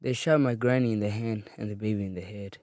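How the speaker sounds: ordinary delivery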